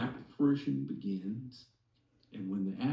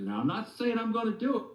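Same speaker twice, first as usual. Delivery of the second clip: higher, fast, defensive wife voice; wife's hedge; 11 semitones higher, faster than usual. Retold or imitated. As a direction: jump up and rush the disclaimer. Wife's hedging retold.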